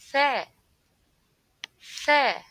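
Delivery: Tha with tongue between teeth, loud; slow exaggerated speech modeling. Shown as a demonstration. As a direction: push out the th in Tha. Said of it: "put your tongue between your teeth".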